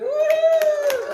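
high loud whoop; woo cheer. Real, not acted. Pastor cheers after singing to Julie, high whoop. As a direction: cheer at the end of the song.

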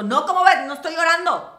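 loud, high, mocking denial; sarcastic outburst. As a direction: shout the denial with biting irony. Sarcastic denial of her rage.

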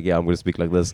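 put-on low-pitched South African accent. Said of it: "I'll just be like".

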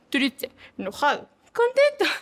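hammed-up sad, angry, then happy with a big high jump; a light Capulina act; mock emotion faces. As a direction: swing through the moods like a comic. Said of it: "hacía las caras así de triste, enojado, contento".

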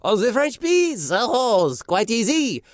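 put-on French accent, high and slow; mock French accent. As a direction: answer like a Monty Python Frenchman.

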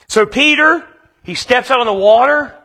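ordinary talk

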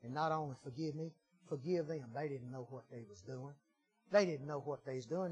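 low, very soft confession; subdued prayerful testimony. Acted. Preacher recounting his prayer of repentance, subdued prayerful testimony. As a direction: drop low and soft, as if praying.

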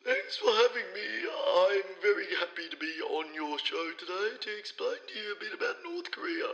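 hoarse, creaky starts to words; croaky voice. Acted. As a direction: croak it out like a sore throat. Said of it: "Sound like you got a bit of a croaky voice there".

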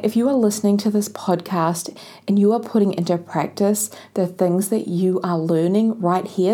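ordinary talk

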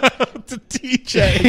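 stretched slow and high; drawn-out high voice. Acted. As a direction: sing it out slowly. Drawn-out loving dedication.

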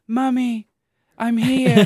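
put-on high eerie little-kid call; creepy child voice. Shown as a demonstration. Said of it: "they record a sound like".

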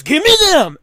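high, loud grunted demand; mock demanding shout. Junker demanding cans from the storyteller, mock shouting. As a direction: bellow it like an insistent junker.

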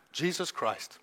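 quiet answer of faith. Retold or imitated. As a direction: answer softly with certainty. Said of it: "I'm like".

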